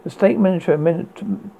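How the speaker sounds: put-on old radio announcer reading news; urgent bulletin read on air.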